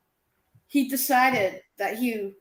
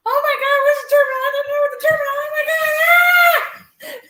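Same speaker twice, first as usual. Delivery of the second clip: panicky; 14 semitones higher, 6 dB louder, faster than usual. Retold or imitated. Panicked call to him on phone.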